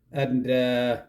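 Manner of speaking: usual speaking voice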